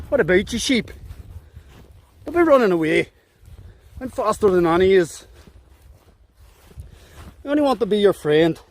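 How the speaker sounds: a lightly put-on Northern Ireland accent, coaxing the sheep. Hillwalker coaxing runaway sheep in a mild Northern Ireland accent. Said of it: "talking to sheep in a Northern Ireland accent".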